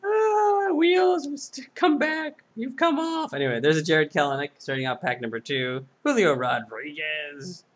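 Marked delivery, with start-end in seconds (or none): 0.0-3.3 high, loud robot wail; a little robot whimpering after its wheels; robot crying. Acted. Robot voice cries over wheels.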